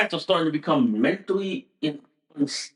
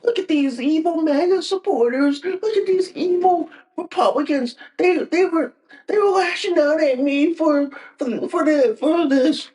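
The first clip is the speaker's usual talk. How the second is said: high whiny complaint; mocking high whine; mock crybaby; 8 semitones higher than usual. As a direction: whimper like a crybaby tattling. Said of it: "don't come crying to my face saying".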